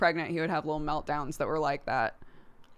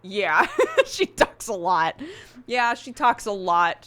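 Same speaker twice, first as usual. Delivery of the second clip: louder, laughing through the words; 5 semitones higher, 7 dB louder, slower than usual. Retold or imitated. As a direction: say it while cracking up. Retelling parents laughing at daycare.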